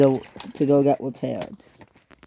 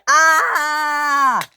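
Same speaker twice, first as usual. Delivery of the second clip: long, loud high wail; sustained falling scream, high and loud; 11 semitones higher, 5 dB louder than usual. Acted. Samson flying through the air, long scream.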